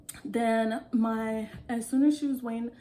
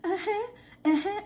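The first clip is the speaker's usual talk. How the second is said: put-on African accent; 10 semitones higher, 3 dB louder than usual. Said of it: "I was like".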